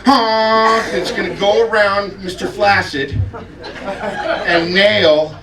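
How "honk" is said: short loud mock horn honk; torpedo honk. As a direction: blast it like a car horn. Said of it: "I invent this torpedo honk".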